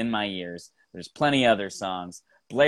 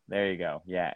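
ordinary talk, nothing marked

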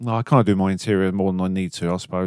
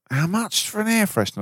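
mimicking coworker; 11 semitones higher than usual. Coworker quoted, high mock voice.

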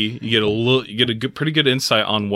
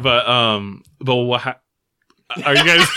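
ordinary talk, nothing marked

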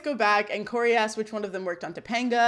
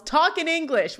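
mild mock yell; 6 semitones higher than usual. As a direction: imitate yelling without much volume. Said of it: "And Sean yells".

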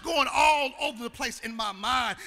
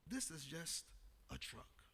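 tender father; 8 semitones lower, 19 dB quieter, slower than usual. Retold or imitated. Father gently comforting son.

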